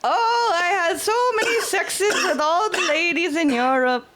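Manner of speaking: put-on high, slow German accent; mock German boaster, laughing. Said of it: "That's what they sound like".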